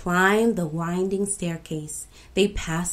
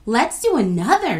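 raised, louder, eager call; eager shout; 5 semitones higher, 7 dB louder than usual. A narrator's reading. Ya asking friends for another story, eager shout. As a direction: call out keenly for more. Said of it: "shouted Ya".

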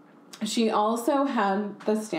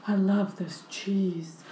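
Marilyn Monroe voice. Retold or imitated. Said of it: "she would just be like".

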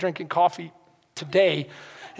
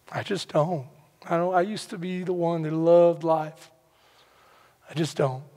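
despondent prayer. Retold or imitated. Retold despondent prayer to God.

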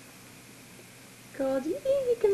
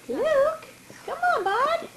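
very high coaxing coo; 13 semitones higher, slower than usual. Real, not acted. Mother coaxing baby.

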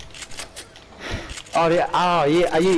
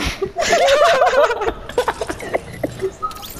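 high laugh. Real, not acted. Gamer cracking up at his own joke, high laughter. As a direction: burst of high cackling laughter.

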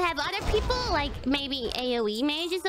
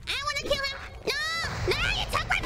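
fast, squealed complaint; high-pitched outrage; 8 semitones higher, much faster than usual. Real, not acted. Player furious at map being taken, high-pitched outrage. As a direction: protest in a high, indignant cry.

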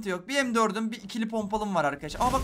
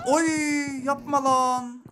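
drawn out slow, higher and louder; dismayed; 8 semitones higher, 5 dB louder, much slower than usual. Real, not acted. Streamer getting shot down, slow dismayed wail. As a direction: stretch it out in dismay.

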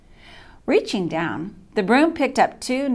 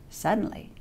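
suspense whisper; 8 dB quieter than usual. A narrator's reading. Sudden turn in the story.

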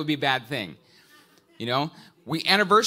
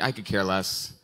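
flat mock indifference; 8 semitones lower than usual.

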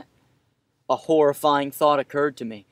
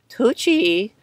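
put-on high girl voice; 7 semitones higher, 4 dB louder than usual. Girl calling out.

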